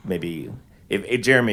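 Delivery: normal talking voice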